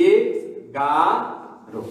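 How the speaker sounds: এগারো drawn out very slowly and softly; slow model pronunciation. Shown as a demonstration. Teacher models the number for students, slow and careful. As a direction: stretch এগারো out for the class. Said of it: "এটা আমরা প্রথমে শিখেছিলাম এগারো".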